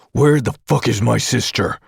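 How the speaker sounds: snarl. Acted. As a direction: bite out the question in fury. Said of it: "I snarl".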